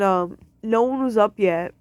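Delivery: normal talking voice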